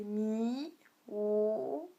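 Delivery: "mi", "ou" drawn out, then rising late; dipping tone. Shown as a demonstration. Teacher showing the third tone, dipping-rising "mi, ou". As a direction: stretch "mi" and "ou" and swing up. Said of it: "faut bien remonter".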